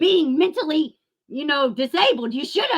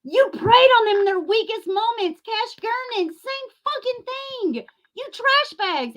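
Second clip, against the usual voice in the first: heated condemnation; 9 semitones higher than usual. Real, not acted. Condemning the channels who preyed on the family, heated. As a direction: lash out at them.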